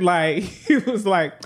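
normal talking voice

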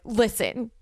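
mock diva demand; self-important. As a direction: huff it out like a celebrity insisting she matters. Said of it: "I'm like".

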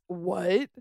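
ordinary delivery

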